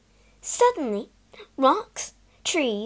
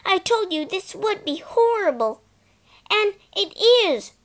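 loud, higher, aggrieved; indignant complaint; 9 semitones higher, 4 dB louder than usual. Acted. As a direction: whine that you were right. Phyllis complaining after tearing dress.

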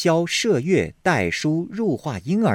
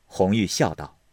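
plain reading voice

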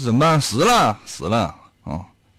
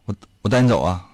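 normal talking voice

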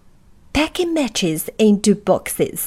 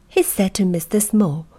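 plain reading voice

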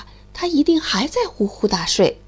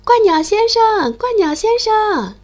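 loud, high calling out; calling loudly; 9 semitones higher, 5 dB louder than usual. Acted. The mole calls loudly for the stork across the pond. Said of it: "大声喊".

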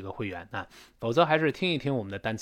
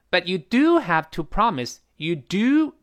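"do" said high and strong; emphatic "do" above "have"; stress on do; 4 semitones higher, 3 dB louder, slower than usual. Shown as a demonstration. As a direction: lift and punch the word "do". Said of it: "这个do一般音调都特别高".

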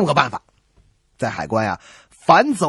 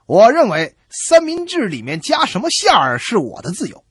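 confident, indignant retort, raised and louder; righteous reply; 5 semitones higher, 5 dB louder than usual. Retold or imitated. Smuggler boldly answers the customs inspector, indignant. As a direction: insist you're perfectly in the right. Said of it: "理直气壮地回答".

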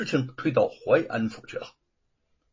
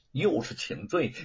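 plain reading voice

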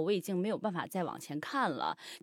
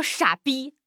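mock yell of an insult, somewhat higher and louder; imitating yourself yelling at someone; retold yell; 4 semitones higher, 5 dB louder than usual. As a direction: throw the insult out in a moderate retold shout. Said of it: "我就对着他喊".

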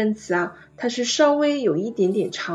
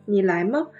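吗 lifted to the top; rising question tune. Shown as a demonstration. Teacher comparing Chinese to French, rising on 吗. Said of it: "最后尾音向上扬".

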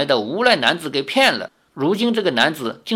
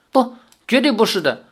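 higher, emphatic denial; hasty protest; 7 semitones higher than usual. A narrator's reading. Suitor defending himself to grandmother, hasty protest. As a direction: jump in to deny it. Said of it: "李逍遥急忙辩解".

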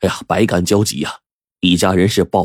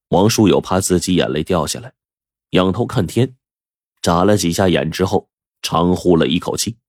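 plain reading voice

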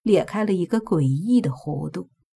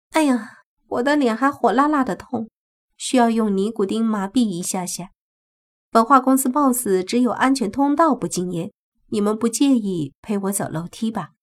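higher, affected complaining voice; put-on sweet complaint, higher-pitched; smug rival woman; 4 semitones higher than usual. Smug rival speaks in story.

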